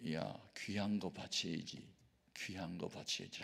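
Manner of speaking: soft, slow inner prompting; quiet, slow and hushed. Retold or imitated. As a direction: hush it like a voice felt in prayer. Holy Spirit prompting in prayer.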